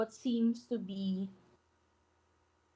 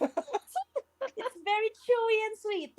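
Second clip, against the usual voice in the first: laughing; 12 semitones higher, much slower than usual. Real, not acted. Narration while laughing.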